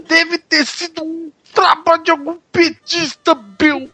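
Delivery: lightly put-on commenter voice; quoting commenter voice. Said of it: "vou repetir aqui porque é um comentário".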